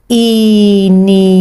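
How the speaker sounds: "Inimigo" drawn out syllable by syllable; slow syllables. Shown as a demonstration. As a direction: sound out "Inimigo" for a child. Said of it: "sílabas". (sílaba por sílaba)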